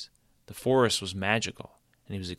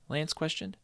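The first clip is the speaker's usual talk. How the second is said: ordinary delivery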